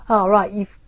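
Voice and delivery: ordinary delivery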